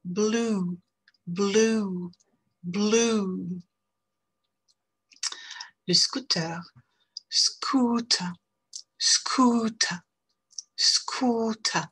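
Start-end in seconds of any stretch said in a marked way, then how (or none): 0.0-3.6 Blue with a long oo, first one whispered; English long oo. Shown as a demonstration. Teacher drilling Blue for French learners with a long oo. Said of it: "On y va". 7.3-11.9 Scooter with a long oo, partly whispered; English long oo. Shown as a demonstration. Teacher drilling Scooter for French learners with a long oo. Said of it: "On y va".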